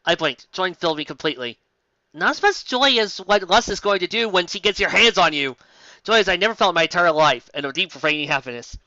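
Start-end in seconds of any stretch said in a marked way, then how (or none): none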